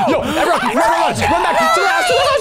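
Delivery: frantic high screaming while fleeing; panicked yelling. Acted. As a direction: yell in panic, running for your life. Fleeing serial killer, panicked yelling.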